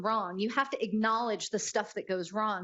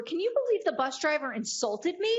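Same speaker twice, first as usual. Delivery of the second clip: higher, indignant mother in a joke; offended mother; 6 semitones higher than usual. Acted. Mother complaining to passenger about driver, indignant and higher. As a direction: play the insulted mom, higher. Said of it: "She tells the guy next to her".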